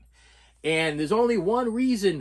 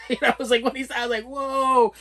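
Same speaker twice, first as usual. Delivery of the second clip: higher, louder, laughing disbelief; laughing recoil; 7 semitones higher, 4 dB louder than usual. Retold or imitated. To the friend's offer, loud laughing whoa.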